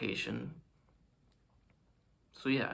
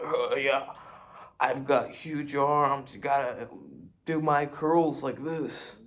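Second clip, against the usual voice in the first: louder, slow swaggering brag; boastful gym bro; 3 semitones higher, 6 dB louder, slower than usual. Pretend. Showing off huge arms to the viewers, slow loud gym bro brag.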